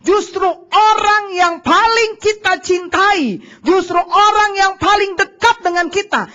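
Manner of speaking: slow, loud, high and drawn-out; slow, emphatic sermon build; emphatic preaching. Acted. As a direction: hammer each word home to the congregation. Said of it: "Dengar baik-baik".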